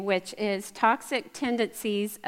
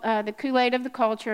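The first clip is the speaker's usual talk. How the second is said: normal talking voice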